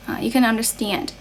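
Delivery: ordinary talk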